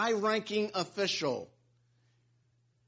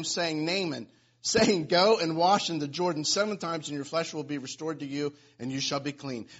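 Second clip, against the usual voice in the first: scripture reading; 4 semitones lower than usual. Acted. Reads scripture passage aloud.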